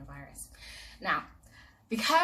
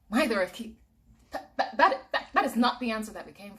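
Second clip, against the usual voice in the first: laughing protest; 3 semitones higher, 8 dB louder than usual. Acted. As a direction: splutter it out, half laughing. Brother protesting the verdict.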